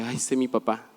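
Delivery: low, soft mocking grumble; annoyed sigh. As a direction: mutter it like a fed-up daughter.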